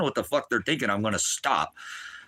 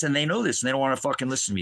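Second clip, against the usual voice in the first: ordinary talk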